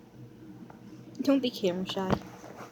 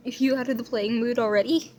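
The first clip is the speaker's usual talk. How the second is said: normal talking voice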